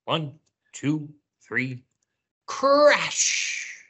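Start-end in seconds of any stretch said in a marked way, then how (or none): 2.5-3.8 high, drawn-out crash; playful high-pitched crash; big crash shout. Pretend. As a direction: call it out with the kids. Crash called out with children.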